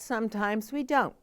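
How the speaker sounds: ordinary delivery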